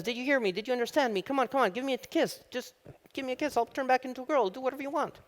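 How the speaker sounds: very high, pleading frog voice; agitated frog. Acted. Frog in the guy's pocket begs to be kissed, agitated high voice. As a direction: beg frantically in a squeaky high pitch. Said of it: "the frog gets agitated and says".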